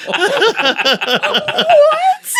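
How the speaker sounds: high, loud "what" through laughter; incredulous laughing. Retold or imitated. Storyteller reacts to "how I pulsate," loud laughing disbelief. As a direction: crack up in disbelief.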